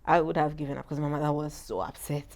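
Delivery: usual speaking voice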